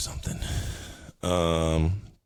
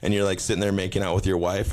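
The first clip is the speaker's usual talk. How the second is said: ordinary talk, nothing marked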